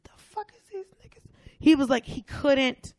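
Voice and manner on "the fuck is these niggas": very soft, high, quick disbelief; hushed, squeaky incredulous aside; imitated bewilderment. Two dudes lock eyes across the crowd, imitated hushed bewilderment. Stunned men trade looks.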